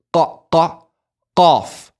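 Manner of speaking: over-do the qa, hitting it hard at the back of the throat; loud, strained Arabic qaf; exaggerated qaf. Shown as a demonstration. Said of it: "really exaggerating right now".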